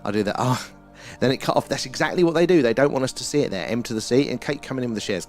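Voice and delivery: ordinary talk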